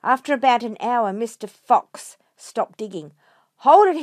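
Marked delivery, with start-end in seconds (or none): 3.6-4.0 high, loud halt call; sharp stop command. Acted. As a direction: stop everyone digging at once.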